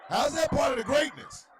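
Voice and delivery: high, loud question through laughter; incredulous laughing shout; laughing disbelief. Real, not acted. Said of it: "repeated take".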